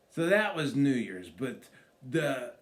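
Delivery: usual speaking voice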